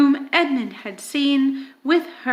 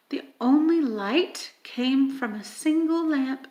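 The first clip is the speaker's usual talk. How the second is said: ordinary delivery, nothing marked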